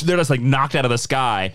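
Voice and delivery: usual speaking voice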